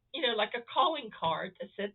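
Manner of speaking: usual speaking voice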